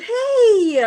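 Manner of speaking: loud, very high, drawn-out hello; exuberant greeting. Retold or imitated. Retelling how she picked up a friend's call, exuberant.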